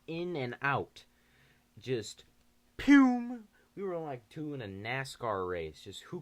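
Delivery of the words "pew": zooming sound. Retold or imitated. Sound of running off.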